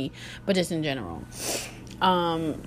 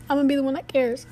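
higher, sing-song self-mockery; a lightly mocked, higher echo of the vow; mocking her younger self; 6 semitones higher, faster than usual. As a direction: poke fun at your own naive vow. Repeats her own resolve, mocking.

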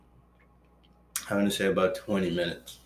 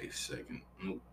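whisper; 16 dB quieter than usual. Real, not acted. Aside while ignoring phone call.

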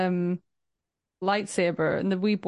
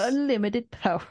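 mock ad-style Palpatine; 4 semitones higher than usual. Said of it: "sounds like an advertisement".

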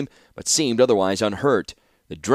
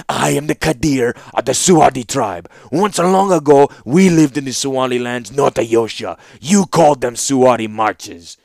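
put-on loud, accented, declamatory young ruler; demanding young ruler; 3 semitones higher, 7 dB louder than usual. A narrator's reading. Young ruler declaring his tribe's claim to the warlord, demanding. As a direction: assert your title and claim with force. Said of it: "demanded the young Falaji".

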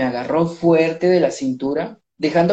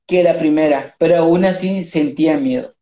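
ordinary delivery

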